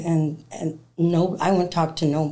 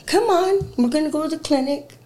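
agitated back-and-forth; 9 semitones higher, 3 dB louder than usual. Retold or imitated. Quarrel on way to clinic.

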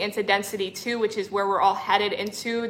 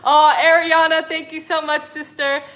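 higher, loud gush of thanks; emotional thanks; 6 semitones higher, 5 dB louder than usual. Real, not acted. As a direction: overflow with gratitude. Thanking a viewer, laughing emotionally.

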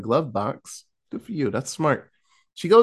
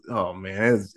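usual speaking voice

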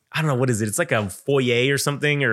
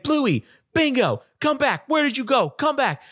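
put-on much higher, slow plaintive kid calling; 10 semitones higher than usual. Kids pounding the screen calling Bluey and Bingo back, high childish voice. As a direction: call after the cartoon dogs like a child.

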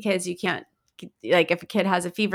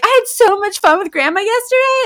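put-on high, loud little-girl glee; bouncy excited child; 11 semitones higher, 6 dB louder than usual. Daughter before school about Grandma, bouncy excited child. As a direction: imitate an excited kid, pitched way up. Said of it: "she was fine bouncing off the walls and was like".

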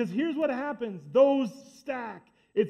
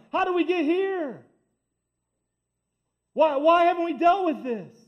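high, loud anguished question; impassioned cry; 6 semitones higher, 5 dB louder than usual. Acted. Preacher pleading with church not to drift, impassioned cry. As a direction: cry it out to the room.

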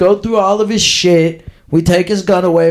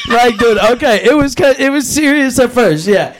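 ordinary talk

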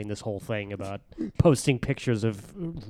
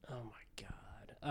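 whisper; 22 dB quieter, slower than usual. Real, not acted. Overwhelmed by the games question.

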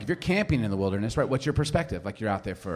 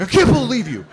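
loud, high mock outrage; hammed-up indignant exclaiming; righteous indignation; 9 semitones higher, 9 dB louder than usual. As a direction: imitate a kid storming off appalled. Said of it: "so much righteous indignation".

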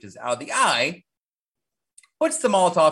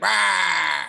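retold monster roar; 4 semitones higher, 4 dB louder than usual. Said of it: "he's like".

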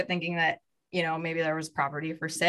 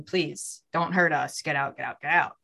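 ordinary talk, nothing marked

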